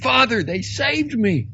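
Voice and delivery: high, loud, breathless joy; excited cry. Acted. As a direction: burst out with the news. Excited report to the other priest.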